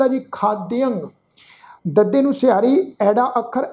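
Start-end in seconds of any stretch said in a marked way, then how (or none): none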